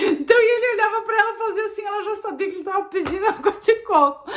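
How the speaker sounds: real laughter breaking through the words; laughing. Real, not acted. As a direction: laugh your way through the funny story. Laughing while telling friend's story.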